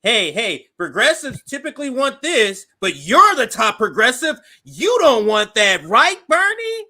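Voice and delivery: loud, high-pitched pressing of a guest; mock TV interviewer. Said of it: "They usually come on".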